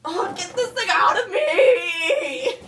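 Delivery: slow, high, loud mock crying; hammed-up pained wail. Woman in labour wails at the doctor, hammed-up. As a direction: wail it out in fake agony.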